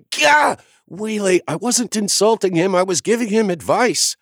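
jolted by the shock, then defend yourself; shocked yelp then protest. A narrator's reading. Sloane zapped and protesting to the kid, high and startled.

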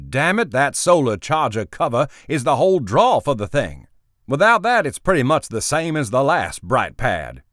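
loud, high exasperated outburst, peaking on "Without that"; irritated boss. Acted. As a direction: snap at the assistant in frustration. Boss angry about product flaw.

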